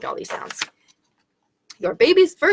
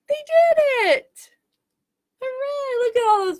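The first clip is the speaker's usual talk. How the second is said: very high, drawn-out cheer; excited cheer. Acted. Celebrating with children at story's end, high excited cheer.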